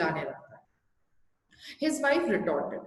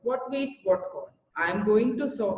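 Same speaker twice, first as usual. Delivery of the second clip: drawn-out question, each word slow; very slow read; much slower than usual. Acted. Teacher reading the husband's puzzled line very slowly.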